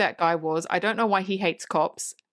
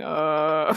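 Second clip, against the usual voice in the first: long, low groan of someone half-conscious; zombie groan; 4 semitones lower than usual. Retold or imitated. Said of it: "it's like a zombie".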